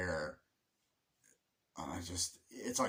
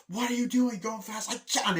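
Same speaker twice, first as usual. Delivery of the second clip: high, loud mock-hysterical screech; imitated loud yelling; 13 semitones higher, 8 dB louder than usual. Driver yelling in the car, imitated loud hysterics. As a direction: shriek it like a frantic film character. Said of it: "how fucking loud she says it".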